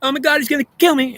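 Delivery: very high-pitched panicked cry; mock terrified. Goddess fleeing from God as the devil, mock terror. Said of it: "you would run away terrified saying".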